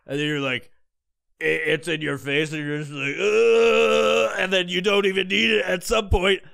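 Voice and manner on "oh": loud, higher drawn-out moan; swelling hammed-up groan; mock moan. Phone up by his face, loud mock moan.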